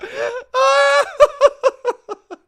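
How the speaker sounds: a high, loud, helpless laugh; loud shrieking laugh. Real, not acted. Reacting to old video.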